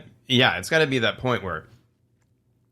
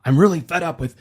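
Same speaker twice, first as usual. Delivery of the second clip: fed-up comedian; 6 semitones higher, 4 dB louder than usual. Acted. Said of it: "And great comedians do that too".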